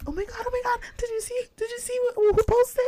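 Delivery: high-pitched frantic mock fangirl; imitated excited gushing. Retelling a friend breaking book news, high excited imitation. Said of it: "because she was like".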